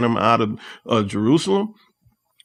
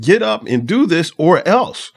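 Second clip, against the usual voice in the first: slower, louder ultimatum; mock threat; 6 semitones higher, 4 dB louder, slower than usual. Joab threatening the king to get up, mock ultimatum. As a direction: lean on every word like a threat. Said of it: "Joab is essentially threatening David".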